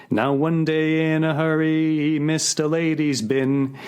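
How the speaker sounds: sing-song recited verse, music-hall lilt; cheery recitation of a Cockney ditty; comic song recital. Acted.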